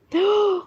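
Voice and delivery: high gasp of realization; short real gasp; sudden gasp. Real, not acted. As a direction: gasp as it dawns on you.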